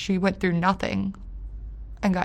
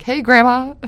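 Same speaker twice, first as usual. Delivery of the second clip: louder, higher, bright greeting; mock cheery call; 5 semitones higher, 10 dB louder than usual. Imitating calling grandma as if nothing happened, mock cheerful. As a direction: sing out a fake-happy hello. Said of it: "call my grandma and be like".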